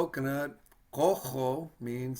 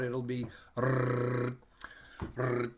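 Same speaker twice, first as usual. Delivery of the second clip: rolling R like a revving motorbike; rolled motor R; slower than usual. Shown as a demonstration. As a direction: trill a long rrr like a motorcycle engine. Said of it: "roll or trill".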